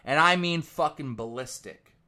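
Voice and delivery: ordinary talk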